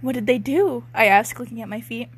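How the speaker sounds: normal talking voice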